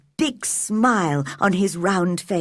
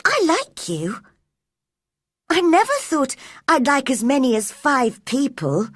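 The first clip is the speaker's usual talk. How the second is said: sudden; 5 semitones higher than usual. A narrator's reading. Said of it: "said Mary suddenly".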